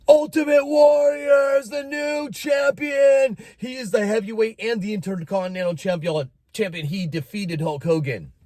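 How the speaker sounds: hammed-up announcer crowning the champ; wrestling announcer. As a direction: boom out the new champion like the arena mic. TV announcer declaring winner.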